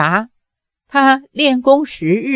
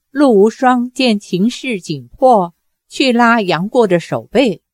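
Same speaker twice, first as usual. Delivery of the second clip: ordinary delivery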